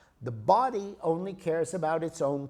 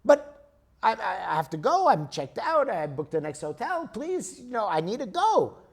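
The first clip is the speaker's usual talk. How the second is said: higher, louder, stammering appeal; frantic pleading; 4 semitones higher, 4 dB louder than usual. Acted. Traveler begs clerk for another train, frantic pleading. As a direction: plead urgently as a stranded traveler. Acted plea of stranded traveler.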